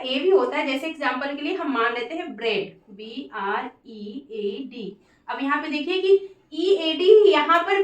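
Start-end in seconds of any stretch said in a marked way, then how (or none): 2.9-4.9 teacher softly spelling B R E ए D; slow spelling. Shown as a demonstration. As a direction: spell B R E ए D very slowly and much softer. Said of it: "pronunciation short ए".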